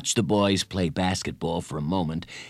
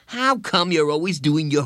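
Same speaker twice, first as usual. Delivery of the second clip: put-on higher, exasperated boyish question; fed up; 6 semitones higher, 4 dB louder than usual. A narrator's reading. Bradley to friend, hands on hips, fed up. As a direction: demand it with growing impatience. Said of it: "Bradley was beginning to get fed up".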